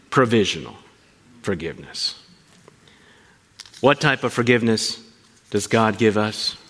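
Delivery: usual speaking voice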